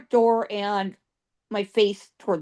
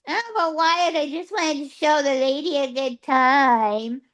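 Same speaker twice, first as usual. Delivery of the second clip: whiny put-on voice of a protesting troublemaker; Ike; 3 semitones higher than usual. Ike retold whining back at Wyatt.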